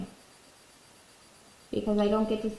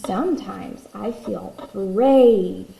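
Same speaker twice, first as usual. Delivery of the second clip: slow, louder brave storybook read; reading to a toddler; 5 dB louder, slower than usual. Acted. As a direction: read it boldly to little ones. Reads book page.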